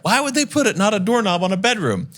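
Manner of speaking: high, puzzled voice of disbelief; high mock-baffled questioning of the realtor; incredulous question. Questioning realtor about locked door.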